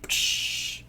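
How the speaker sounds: long voiceless psh; whooshing jet noise. Retold or imitated. Reviewer imitating Iron Man-style firebending, long hissed whoosh. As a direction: imitate a rocket taking off with breath alone.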